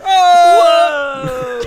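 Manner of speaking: long, high, loud "oh"; loud yell. Pretend. Player reacts to an opened door, long yell. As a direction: yell it out and hold it.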